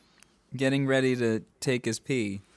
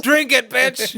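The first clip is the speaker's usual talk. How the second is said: mock wrestler taunt; 12 semitones higher, 8 dB louder, slower than usual. Wrestler pours gravy on enemy.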